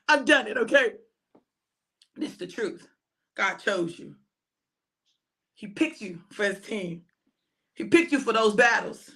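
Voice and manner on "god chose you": usual speaking voice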